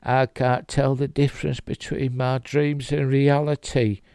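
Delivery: mock Cockney-ish British accent, lightly done; imitating Oscar Isaac's British accent. Vlogger mimicking Moon Knight's Stephen, British accent. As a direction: put on a stagey London accent. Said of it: "Oscar Isaac's British accent".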